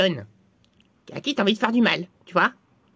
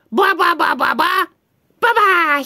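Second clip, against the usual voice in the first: chanted spell, higher and louder; magic formula; 6 semitones higher, 4 dB louder, slower than usual. Acted. Two friends share the spell, chanted incantation. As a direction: chant the syllables like a silly incantation. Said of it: "je fais le début, et toi, tu fais la fin".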